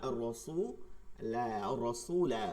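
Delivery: আর রসুলা with the final লা stretched at the stop; drawn-out pause form. Shown as a demonstration. Teacher shows the stop on আর রসুলা with a stretch. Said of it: "টান দিয়েই থামবে".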